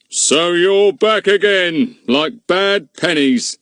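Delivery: high, slow, pompous bluster from the village bobby; gruff policeman. A narrator's reading. Policeman sneering at the returning children, slow and pompous. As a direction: sneer it out slowly as a cross constable. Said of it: "His frog eyes glared at them".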